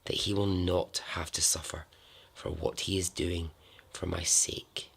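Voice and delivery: hushed, sombre reading with long pauses; solemn letter reading. Acted. Storyteller reads a girl's last letter before her death, hushed and solemn. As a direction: read it softly and evenly, weighing each phrase.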